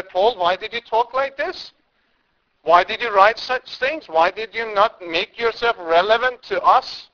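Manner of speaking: hammed-up complaint, scolding an apostle; sarcastic. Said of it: "I am being sarcastic here".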